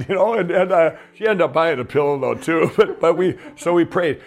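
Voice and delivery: chuckling through the words, pitch up; laughing. Real, not acted. Caller story amuses him, laughing as he talks. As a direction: laugh as you tell the punchline. Amused while retelling prayer calls.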